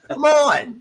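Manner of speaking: pitched high in disbelief, come on; fed-up cry at lazy writers; exasperated. Real, not acted. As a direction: throw up your hands at them. Exasperated at creative's naming.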